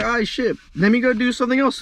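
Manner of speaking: high, loud annoyed sigh of defeat; frustrated giving up. Retold or imitated. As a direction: shrug it off in annoyance. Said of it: "So I was like".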